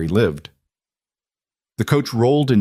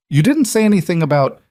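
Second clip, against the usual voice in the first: higher-pitched, sputtered objection; flustered protest; 9 semitones higher, 4 dB louder than usual. Acted. Groom objects to his mail-order bride's appearance, flustered. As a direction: protest in a raised pitch, then break off.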